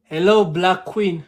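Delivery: usual speaking voice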